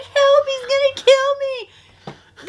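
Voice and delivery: high, slow hammed-up cry for help; mock panic. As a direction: whine it like a terrified kid. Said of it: "Alex is like".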